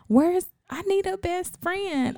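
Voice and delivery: put-on high, slow whine of a girl wanting a best friend; mock younger self. A girl to her friends, whining high for a best friend.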